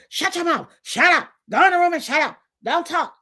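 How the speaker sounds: put-on high, harsh parent shutting a kid down; mock angry parent. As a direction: bark it like a fed-up parent. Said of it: "This is a favorite".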